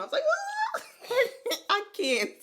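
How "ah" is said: a high laughing shriek; mock scream. A patient hearing "tumor" again shrieks, laughing. Reacting to doctor saying tumor.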